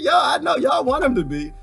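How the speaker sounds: retold call-out. Retelling his own reply.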